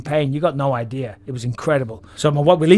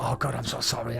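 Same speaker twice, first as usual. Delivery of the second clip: much softer, sheepish sorry; sheepish apology; 3 semitones lower, 11 dB quieter than usual. Retold or imitated. Patient apologizes to doctors after the anesthetic, soft and sheepish. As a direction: mumble an embarrassed apology. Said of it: "I'm apologizing to all the doctors".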